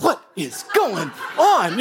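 slow, stretched-out bewildered question; slow bewilderment. Retold or imitated. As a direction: drag it out like you can't believe it. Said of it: "And I'm like".

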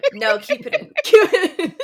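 high, loud, laughing; real giggly insistence; laughing insist. Real, not acted. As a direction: laugh while begging to keep it.

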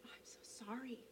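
gently whispered comfort; soft whisper. Retold or imitated. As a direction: breathe the words softly, barely voiced. Comforting a crying stranger.

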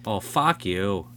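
normal talking voice

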